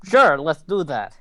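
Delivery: normal talking voice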